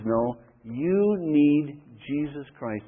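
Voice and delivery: slow, drawn-out declaration; solemn emphasis. Real, not acted. Christianity answers religion's claim, slow solemn emphasis. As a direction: stretch each word for weight.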